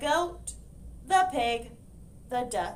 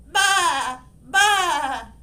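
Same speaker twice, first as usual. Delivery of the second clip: loud bleating baa; goat bleat; 5 semitones higher, 12 dB louder than usual. Acted.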